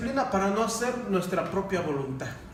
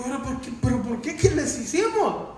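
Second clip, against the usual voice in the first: rising pleading questions, louder and higher at the end; hurt bewilderment; 7 semitones higher, 4 dB louder than usual. Retold or imitated. Pastor acting out his own puzzled plea to snubbing brothers, hurt bewilderment. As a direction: ask it like you truly don't understand the snub.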